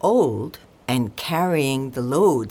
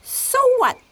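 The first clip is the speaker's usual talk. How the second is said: very high donkey exclamation; exclaiming donkey; 4 dB louder than usual. A narrator's reading. Donkey dismissing the cat's complaint, high-pitched exclamation. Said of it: "the donkey exclaimed".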